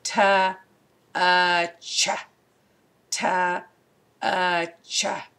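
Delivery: slow segmented sounding out; sounding out touch. Shown as a demonstration. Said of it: "this word again is".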